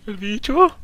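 high, loud frantic yelling; screaming in real panic at the monster; real terrified shouting; panicked. Real, not acted. Panicked chase in horror game.

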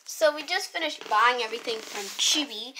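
ordinary talk, nothing marked

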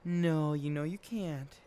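high, soft mother's voice; mom voice. Acted. Mom calling Ophelia to bed in a high soft voice. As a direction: call the child up sweetly like a mom.

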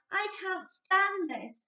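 high, slow, complaining; fed-up Mister Bear. A narrator's reading. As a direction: drag it out like a sleepless father. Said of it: "said Mister Bear".

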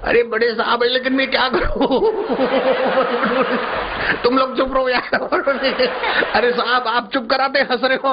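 high voice breaking into giggles; laughing while talking. Acted. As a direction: officials who can't stop laughing. Officials questioning each other, all laughing.

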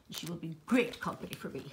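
ordinary talk, nothing marked

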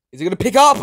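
high, loud tense shout; shrieking question; anxious squeal. Real, not acted. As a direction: yelp in suspense. Waiting for the soul.

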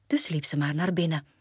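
ordinary delivery

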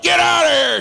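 high, loud yell in the middle of labor; wife yelling. Retold or imitated. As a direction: bark it like a wife in labor pain. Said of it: "she yelled at me one time".

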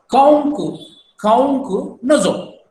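"Kaunk, kaunk nozo" said as a firm order, the first Kaunk punched out; stern no-eating command. Shown as a demonstration. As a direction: order someone not to eat, slowly. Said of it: "you're sort of commanding, ordering".

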